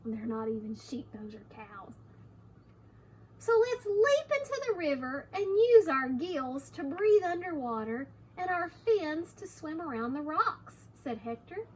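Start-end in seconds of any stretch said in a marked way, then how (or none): 3.4-10.9 high, lively rallying character; eager storybook chicken. Acted. Hector urges Harriet into the river, eagerly. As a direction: propose an adventure, pitch lifted and swinging. Reads Hector's plan to children.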